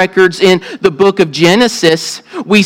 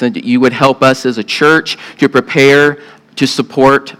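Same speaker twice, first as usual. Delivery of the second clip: prayer; 5 semitones lower than usual. Acted. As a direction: pray it reverently, unhurried. Pastor prays before the sermon.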